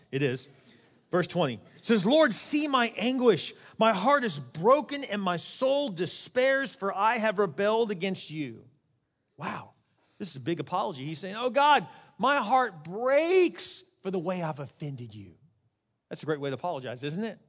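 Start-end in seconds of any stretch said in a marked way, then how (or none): 2.0-8.6 loud, drawn-out, high-pitched lament; anguished scripture reading. Acted. Preacher reads the congregation a broken-hearted apology to God, anguished. 11.3-15.3 heartfelt cry, starting high and loud; anguished apology. Acted. Preacher voicing the sinner's big apology to God, anguished.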